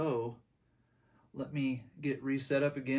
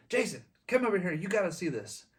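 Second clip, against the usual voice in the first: higher, quick mock call across the booth; imitating calling out; 6 semitones higher, faster than usual. Owner calling his switchman over to see the piece, in a light mock call. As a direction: wave a friend over, pitched up and fast.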